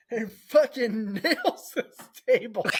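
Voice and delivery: high, loud, laughing through the words; real helpless laughter. Real, not acted. Friend tells of kid hitting table, laughing helplessly. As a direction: crack up while telling it. Teller cracks up at own story.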